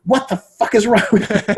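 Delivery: loud, high, incredulous outburst; exasperated scolding, played for laughs; mock outrage. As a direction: explode in comic disbelief. Mock outrage at the whining student.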